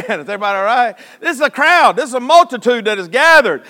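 high, loud, full-force pulpit delivery; fired-up preaching. Real, not acted. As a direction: drive it up to an excited shout. Preaches the crowd's excitement.

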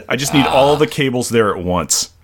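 ordinary talk, nothing marked

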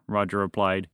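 usual speaking voice

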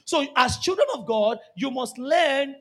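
ordinary talk, nothing marked

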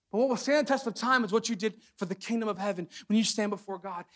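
ordinary talk

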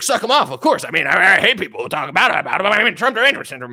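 high, loud mocking fan voice; mock Trump fan. Trump fan picking the worse option, mock high loud voice. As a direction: sneer it out as a smug fan.

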